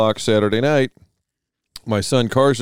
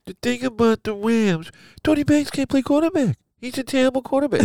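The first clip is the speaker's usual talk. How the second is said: much higher pinched-nose sports talk; Joel Bushbaum; 12 semitones higher, 3 dB quieter than usual. Retold or imitated. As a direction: squeeze your nose and opine. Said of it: "pinching my nose doing Joel Bushbaum".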